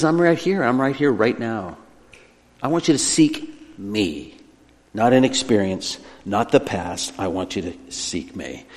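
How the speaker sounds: quiet, patient calling; gentle appeal. Acted. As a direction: trail down softly with spaced phrases. God's gentle appeal, voiced by preacher.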